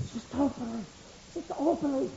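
put-on soft, high little-boy whisper; hushed child calling in church; child's whisper. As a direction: whisper her name like a small boy.

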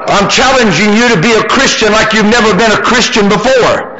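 high-pitched, driving pulpit delivery; full-force preaching. Real, not acted.

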